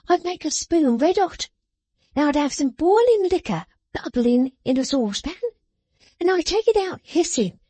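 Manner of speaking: high, sharp scolding voice; scolding little woman. A narrator's reading. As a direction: scold him like a child. Jenny's gleeful threat.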